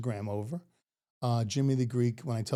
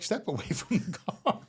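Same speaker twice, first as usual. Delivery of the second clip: laughing command; 7 semitones higher, slower than usual. Retold or imitated. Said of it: "So she's like".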